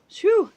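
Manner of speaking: loud, high "whew" of relief; relieved whew. Real, not acted. Host relieved after fixing noisy notifications, loud whew. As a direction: blow out a quick, high whew.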